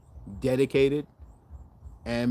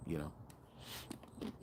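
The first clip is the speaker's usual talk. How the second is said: a much softer whispered "you know"; 7 semitones lower, 20 dB quieter than usual. Real, not acted. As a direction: drop to a breathy aside. Trailing aside under his breath.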